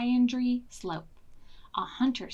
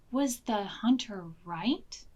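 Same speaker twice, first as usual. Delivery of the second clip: usual speaking voice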